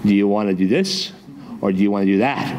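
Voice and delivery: low, measured dad laying out the options; stern dad. Pretend. Dad giving his kid a choice of punishments, low stern voice. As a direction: pretend to be a dad, voice dropped low. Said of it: "what if your dad came in".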